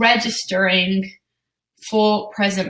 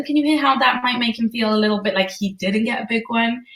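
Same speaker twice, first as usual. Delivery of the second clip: ordinary delivery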